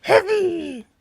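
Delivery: very high, drawn-out silly character voice; Heavy voice. Acted. Gamer imitating a Team Fortress Two character, high silly voice. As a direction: squeal it high and stretch the end. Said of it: "Where did the heavy voice come from?".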